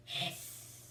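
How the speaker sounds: a slightly stronger hiss; a rising hiss; whispered hiss. A narrator's reading. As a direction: let the hiss grow a little. Narrated paint box hissing.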